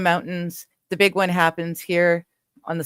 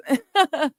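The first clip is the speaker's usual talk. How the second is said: short high giggle; a quick high laugh; 10 semitones higher than usual. Real, not acted. Laughing at chat.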